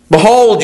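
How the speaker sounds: "Behold" thrown out loud and high, falling; emphatic behold. Shown as a demonstration. Preacher warning the despisers, "Behold" loud and falling. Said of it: "illustrating the emphatic word".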